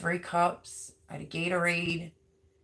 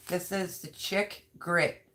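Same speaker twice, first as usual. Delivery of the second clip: ordinary talk, nothing marked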